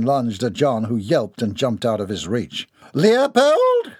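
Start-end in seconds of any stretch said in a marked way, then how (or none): 2.9-3.9 high, loud commanding call; resonant authority. A narrator's reading. Mother halting her lunging son, with authority. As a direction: call him sharply to heel. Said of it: "Her voice resonated with authority".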